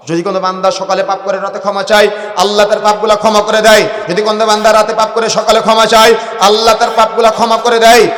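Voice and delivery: loud, incantatory sermon cadence; rhythmic preaching. Real, not acted. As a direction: drive each parallel phrase home alike. Rhythmic preaching in parallel lines.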